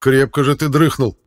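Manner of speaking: loud, mocking jibe; teasing. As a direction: rib him loudly. King teasing sleepy Erik.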